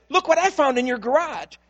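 put-on a small boy showing off a spider; a boy to the lady, proudly; proud little boy. Small son voiced proudly.

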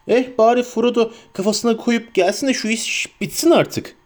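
put-on high-pitched, impatient young hobbit voice; sabırsız Pippin: impatient hobbit. A narrator's reading. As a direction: complain in a high, restless voice. (tiz, sabırsız hobbit sesi)

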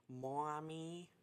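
put-on lisping kid. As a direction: say it with a little lisp. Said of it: "a little bit of, like, a lisp".

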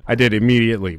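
ordinary talk

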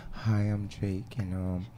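soft, hushed imitation. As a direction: say it all soft and quiet. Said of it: "Jake like to talk all soft".

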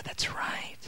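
soft, hushed "that's right"; whispered praise. Retold or imitated. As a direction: gently affirm in a whisper. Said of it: "I go".

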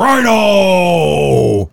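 high, loud drawn-out announcer shout; monster truck ad. Retold or imitated. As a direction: hold it out like a monster truck promo. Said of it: "I'm gonna mute you".

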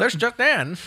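put-on old-timey radio announcer, high; 1950s narrator. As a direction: cut in like a breaking news bulletin.

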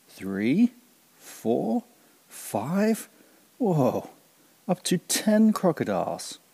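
a small clever creature counting with growing excitement; Kancil voice. Acted. Kancil counting the crocodiles, high and eager.

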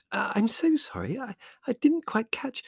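high, then whispered apology; fumbling embarrassed high voice; flustered Mother. A narrator's reading. Mother failing to understand the customs man, flustered.

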